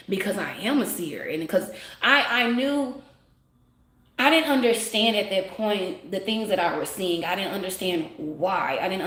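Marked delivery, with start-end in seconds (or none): none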